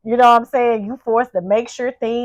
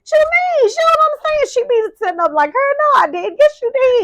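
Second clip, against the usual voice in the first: imitating mom; 13 semitones higher than usual. Imitating strict mother arguing.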